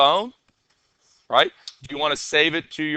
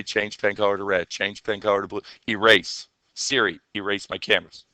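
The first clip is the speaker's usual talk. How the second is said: lower, softer voice giving commands to the device; voice command demo; 7 semitones lower, 6 dB quieter than usual. Shown as a demonstration. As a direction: speak as if dictating to Siri. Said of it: "We'll do the voice and say".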